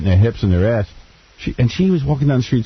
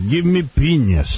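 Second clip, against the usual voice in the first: higher, slow mock German demand; mock German accent; 4 semitones higher, slower than usual. Friend joining the German girl bit, mock German accent. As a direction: order it stiffly in German style.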